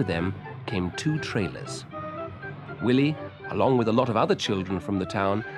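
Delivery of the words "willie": usual speaking voice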